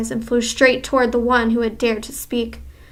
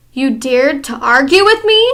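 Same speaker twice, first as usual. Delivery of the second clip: louder mock scream; 2 semitones higher, 7 dB louder than usual. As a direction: scream the challenge. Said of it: "screamed the Grand High Witch".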